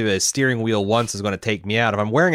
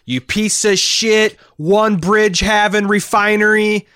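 loud, slow, higher yell; angry yelling; 11 semitones higher, 6 dB louder, slower than usual. Retold or imitated. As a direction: bellow it like a ranting comic. Imitating a comedian's tirade.

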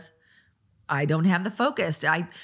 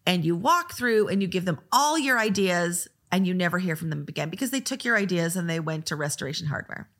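ordinary delivery

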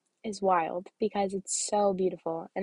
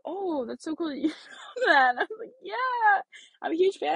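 laughing through the words, higher; 9 semitones higher than usual. Real, not acted. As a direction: giggle while quoting. Laughs while retelling exchange.

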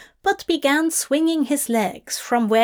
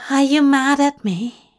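higher, anxious little question; small, worried, higher voice; timid question; 4 semitones higher, 4 dB louder than usual. Acted. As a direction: ask as if dreading the answer. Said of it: "not looking up".

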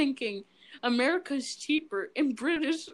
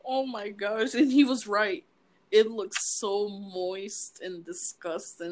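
ordinary talk

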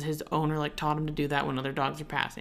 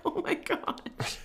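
breathless gasped whisper, much higher and slow; panicked gasp; 14 semitones higher, 6 dB quieter, much slower than usual. Retold or imitated. Dog owner recalling her dog lunging, gasped high and breathless. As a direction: gasp it out high as the leash goes up. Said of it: "because I was like".

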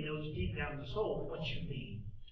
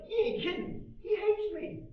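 much higher, louder whiny voice; aggrieved victim; 4 dB louder than usual. Retold or imitated. Preacher mimics someone hurt by a joking insult, high whine. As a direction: play the offended one, wounded and griping. Imagined wounded listener's complaint.

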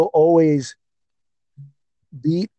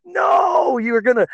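upset retold shout. Fan so upset watching the takedown, retold protesting shout. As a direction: cry out in mock anguish, high-pitched. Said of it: "like, so upset".

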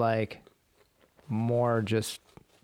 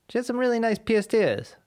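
"pierced ears" as piest iz, higher pitch; Rhode Island accent; 7 semitones higher than usual. Retold or imitated. Joining in the local impression, Rhode Island accent. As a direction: Rhode Island gossip, R dropped.

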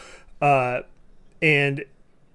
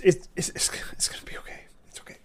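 whispered, trembling reassurance to himself; scared whisper; 4 semitones higher, 5 dB quieter, much faster than usual. Acted. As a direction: murmur it over and over like a frightened man. GM voices rocking man whispering.

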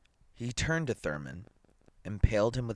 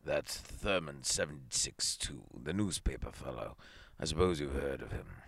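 sullen mutter; 5 semitones lower, 4 dB quieter than usual. A narrator's reading. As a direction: grudgingly mumble it out, low. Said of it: "muttered sullenly".

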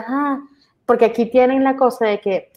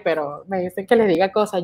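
usual speaking voice

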